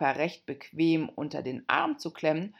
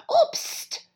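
very high, sharp panicked hush; fearful whisper; 7 dB louder than usual. Acted. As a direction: shush her in alarm. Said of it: "flüsterte das Kaninchen furchtsam".

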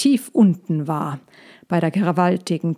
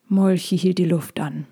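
usual speaking voice

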